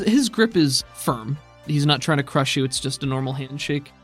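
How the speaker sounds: ordinary delivery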